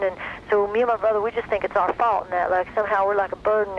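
lightly put-on Texas teen accent, earnest; imitating his cute Texas accent. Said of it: "I'm gonna imitate him 'cause he's got the cutest accent".

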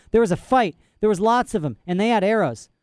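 put-on insistent girl. A narrator's reading. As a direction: insist like a frightened child.

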